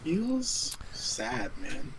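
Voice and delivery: hushed mock-sad whisper. Real, not acted. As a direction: breathe it out softly.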